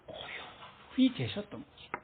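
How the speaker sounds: soft unvoiced whisper, slowing down. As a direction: breathe the words out, barely voiced. Jesus resting in silence. (속삭이듯 조용히)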